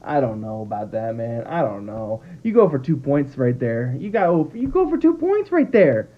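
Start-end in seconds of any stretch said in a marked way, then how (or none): 4.0-6.1 much higher, heated repeat of the call; exasperated insistence. Real, not acted. Fan frustrated at the coach insists on going for two, much higher and heated. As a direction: hammer the point home in disbelief.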